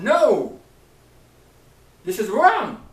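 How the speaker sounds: sharp high shout of NO. Retold or imitated. Preacher retelling his protest to God, a loud shouted no. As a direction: cry out NO at full force. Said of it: "I said".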